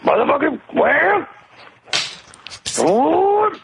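high hammed-up yelling at a whale; high mock rant. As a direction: squeal it out, mock furious.

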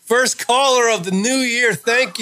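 slow, drawn-out winner announcement; radio contest promo. Acted. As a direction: announce the first caller proudly.

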